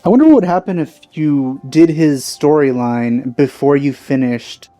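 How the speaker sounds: normal talking voice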